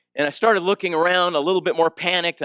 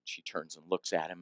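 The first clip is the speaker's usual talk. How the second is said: normal talking voice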